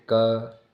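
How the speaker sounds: "क" held, with little air released; low-breath consonant. Shown as a demonstration. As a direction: sound out "क" slowly with minimal puff. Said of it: "अपन कम हवा". ("क" को कम हवा से, खींचकर)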